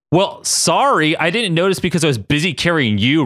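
super annoyed. Acted. Ryan defends himself to Katie, super annoyed. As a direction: snap back in high-pitched annoyance. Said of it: "suddenly super annoyed".

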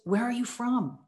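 condescending. Retold or imitated. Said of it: "almost, you know, condescending, like it's a, a command".

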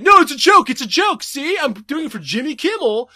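fake-cheery boss laughing it off; mock boss backpedaling. Boss claiming the firing was a prank, high mock backpedal. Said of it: "He's like".

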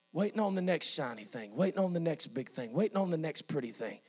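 low, soft, intimate preaching; quiet, reflective sermon voice; hushed preaching. Acted. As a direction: drop right down and confide it to the congregation.

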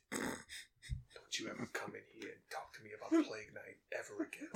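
hushed, breath-only mob threat; mobster threat. Acted. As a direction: drop to a menacing whisper. Playing mobster threatening quietly.

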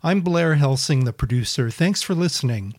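loud, bright announcer-style podcast intro; podcast intro read. Acted. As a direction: read it like a radio show opener.

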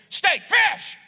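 hammed-up couple yelling food choices; shouting match. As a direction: bark it like a fight over dinner. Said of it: "it sounds like this".

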